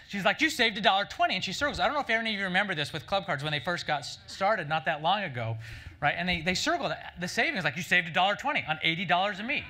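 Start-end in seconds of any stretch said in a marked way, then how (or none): 0.4-1.3 high, loud thrilled cashier; excited cashier. Retold or imitated. Cashier thrilled about a shopper's savings, loud and high. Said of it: "the lady goes nuts". 7.8-9.6 loud, high gushing cashier; excited cashier. Retold or imitated. Cashier circling a shopper's receipt, excitedly loud. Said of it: "And she was very excited".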